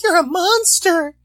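high, loud, drawn-out mock shriek; mock horrified cry. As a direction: cry out in exaggerated horror at a monster. Voicing the horrified rescued woman.

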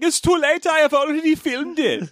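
put-on high, insistent German accent; hammed-up comic German protest. Actor refusing to drop the accent, high German accent. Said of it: "try out my German accent".

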